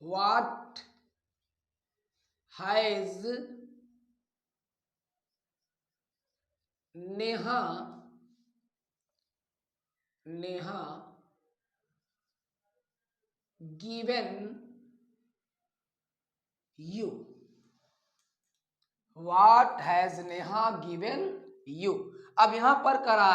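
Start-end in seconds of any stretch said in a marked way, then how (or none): 0.0-17.1 slow dictation. Shown as a demonstration. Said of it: "dictating word by word". 19.2-21.9 very slow, word by word; slow model reading. Shown as a demonstration. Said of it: "model sentence read slowly".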